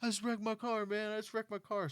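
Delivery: high, whining mock streamer voice; whiny crash guy. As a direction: play him as a dazed whiner. Streamer after crashing car.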